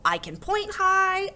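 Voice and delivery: "high" leaps up and holds; high singsong. A narrator's reading. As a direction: chant it, shooting up on "high". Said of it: "thinking about high and low and start to sing".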